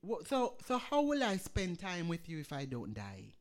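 rising, loud sermon delivery with surges; full-force preaching. Acted. Pastor exhorting the congregation in wartime fear, preaching at full force.

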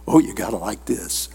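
ordinary delivery

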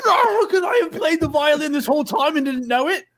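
high-pitched wailing question; distraught high voice; anguished disbelief. Acted. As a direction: wail it in hammed-up despair. Character in mock anguish over violin.

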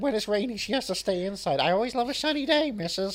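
film chauffeur impression. Chauffeur telling missus about his daughter, put-on character voice. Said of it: "Does anyone remember my character".

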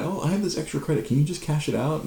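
high, coy sweet request; high coy voice of the flirting assistant; flirty woman. Retold or imitated. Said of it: "she'd bring Jai".

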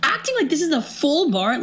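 high-pitched, worked-up rant; hysterical. Retold or imitated. Customer ranting at bartender over ruined night, hysterical. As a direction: imitate yourself losing it at someone. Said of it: "I'm, like, hysterical".

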